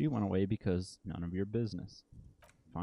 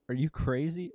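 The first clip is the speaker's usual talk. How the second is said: shocked outburst; 8 semitones higher, 6 dB louder than usual. Acted. Suspect accused of murder.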